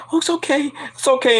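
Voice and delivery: mock consoling. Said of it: "he's like".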